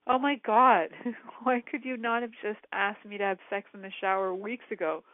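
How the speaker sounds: hammed-up exasperation, stretching the last exclamation; exasperated disbelief. Wife retelling her reply to her husband's late yes, exasperated.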